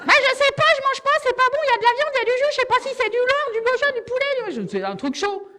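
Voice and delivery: put-on high, loud kid voice rambling; a fussy child unsure what he ate; whiny child voice. Child telling mum about the canteen meat, high and whiny.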